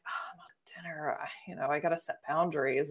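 fast, whispered under the breath; whispering to herself at the dinner table, fast and hushed; whispered aside. Retold or imitated. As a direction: rattle it off in a whisper, as if to yourself.

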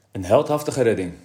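ordinary delivery

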